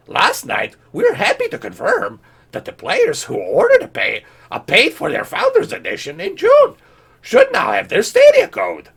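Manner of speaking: very high squeaky mock Yoda reading. Mocking Google's tweet, squeaky Yoda voice. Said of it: "I'll do this in a Yoda voice".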